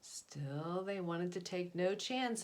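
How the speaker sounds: plain reading voice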